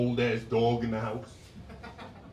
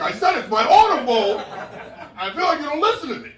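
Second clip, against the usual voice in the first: high, loud insistent repeat; exasperated woman; 12 semitones higher, 8 dB louder than usual. Acted. Woman repeats herself to clueless visitor, exasperated and high. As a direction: insist as if nobody listens.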